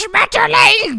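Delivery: slow, loud, high mock German shout; angry German. Imitating the angry German voice from the dub. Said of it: "the quintessential angry German".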